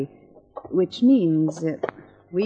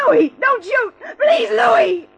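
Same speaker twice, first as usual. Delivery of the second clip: high, loud frightened shout; terrified pleading; 6 dB louder, slower than usual. Acted. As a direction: beg him not to shoot. Woman held at gunpoint begs.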